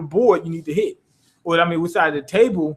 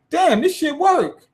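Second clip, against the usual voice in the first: surprised; 9 semitones higher, slower than usual. Retold or imitated. Player surprised the routine works.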